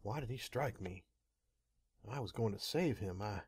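ordinary delivery

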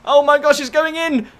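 high, urgent gasp of commentary; rising match excitement; excited alarm. Real, not acted. Ball heading into the hoop.

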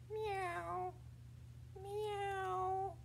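high, soft meowing; cat meow. Pretend. Teacher hinting at the tail's owner, soft meow. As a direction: mew gently like a cat.

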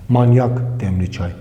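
usual speaking voice

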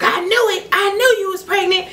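high-pitched excited exclamation; mock yelling. Cousin finding out about pregnancy, mock yell. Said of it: "And he yelling".

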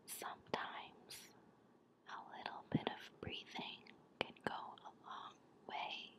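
close, hushed guided-breathing whisper; whispered relaxation. Real, not acted. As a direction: whisper calm reassurance with long pauses.

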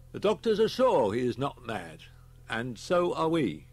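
grave inspector. Acted. Inspector briefs Holman on the school deaths, grave. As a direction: report the grim facts firmly.

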